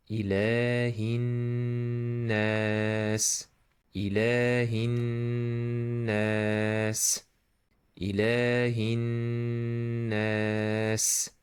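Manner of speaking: "Ilahin nas" recited with long "la" and long humming n; held nun, stretched vowels. Shown as a demonstration. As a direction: sustain the n, then draw out "nas". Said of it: "Like this".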